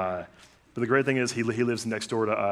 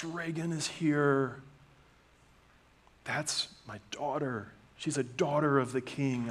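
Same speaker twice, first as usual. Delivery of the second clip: high, slow, proud and gentle; God's welcome; 4 semitones higher, much slower than usual. Acted. God proudly announcing his children as they enter, high and slow. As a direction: proclaim each child's arrival with joy.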